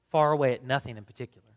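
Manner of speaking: usual speaking voice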